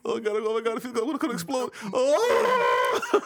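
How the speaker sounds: high, hammed-up moaning; imitating porn star. Joking with a friend about porn, hammed-up high moans. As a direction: overdo the ecstatic cries for laughs. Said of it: "I'm like".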